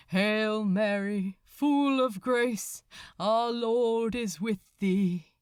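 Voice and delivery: slow, solemn priest intoning a prayer; intoned prayer. Acted. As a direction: chant it like a priest over the chaos. Priest prays aloud on deck.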